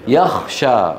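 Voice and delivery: يخشى with kh replacing the gh of يغشى; Kha-for-Ghain error. Shown as a demonstration. Teacher showing students the mistake, غ turned to kh. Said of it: "إبدالها خاء".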